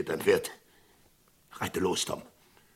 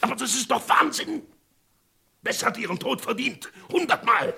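much higher, frantic insistence; agitated pleading; 3 dB louder than usual. Acted. Sheriff frantically pleading with Tom.

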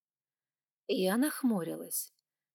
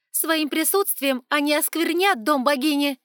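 nearly shrieking; 8 semitones higher, 11 dB louder than usual. A narrator's reading. Evans protests in rising panic.

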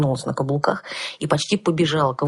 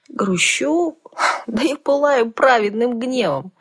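put-on young girl voice; 8 semitones higher, slower than usual. A narrator's reading. Heroine protests to dragon.

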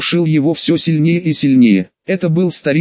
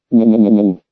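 ghostly weeping wail; 5 semitones lower than usual. A narrator's reading. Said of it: "Дно океана начало реветь".

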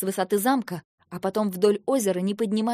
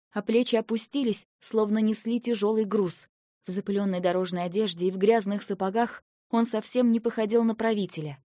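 ordinary delivery, nothing marked

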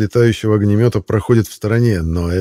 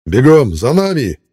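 loud, slow barked order; commanding; 4 semitones higher, 4 dB louder, slower than usual. A narrator's reading. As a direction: command them to run after you. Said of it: "командует Герат".

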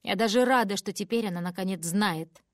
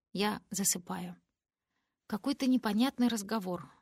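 plain reading voice

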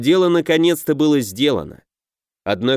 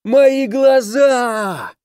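much higher, louder, very slow shout; pained shout; 11 semitones higher, 4 dB louder, much slower than usual. A narrator's reading. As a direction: howl it out in agony. Demon blinded, screaming in pain.

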